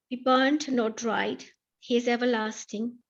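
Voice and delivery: ordinary talk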